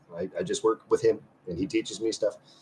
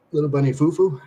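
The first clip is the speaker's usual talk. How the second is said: silly sing-song; 5 semitones higher, 3 dB louder than usual. Pretend.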